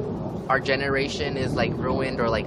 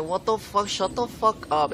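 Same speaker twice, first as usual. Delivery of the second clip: higher, exaggerated indignant snap; mock outraged retort; 7 semitones higher than usual. Answering the teacher, high mock-outraged retort. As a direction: fire back in mock outrage. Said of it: "I'm like".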